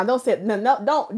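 usual speaking voice

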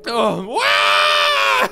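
high, loud crying wail; child's wail. Acted. Frightened Fluffy breaking down, loud childish wail. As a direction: bawl like a little kid. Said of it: "look at, he's crying".

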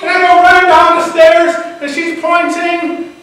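high, loud, stretched-out excitement; thrilled, full-volume storytelling; excited telling. A narrator's reading. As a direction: shout the story at its climax. Breakneck retelling of the discovery.

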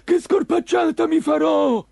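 high, loud greedy exclamation in a put-on voice; gleeful fisherman. Green fisherman hauling in his nets, delighted and loud. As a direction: crow with delight over the coming feast. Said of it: "esclamò tutto contento".

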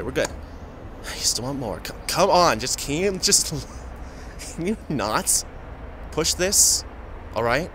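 normal talking voice

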